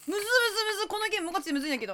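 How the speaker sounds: high, fast frustrated babble; flustered rush. Real, not acted. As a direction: rattle it off in frustration. Struggling with hard game. (早口で焦って)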